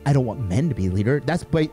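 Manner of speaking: ordinary talk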